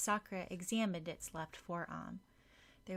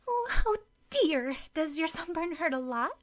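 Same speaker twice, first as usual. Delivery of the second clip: much higher, louder fussing concern; fussing woman; 11 semitones higher, 11 dB louder than usual. Acted. Woman walks in and fusses over the sunburn, high gushing concern. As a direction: gush over the sunburn in alarm.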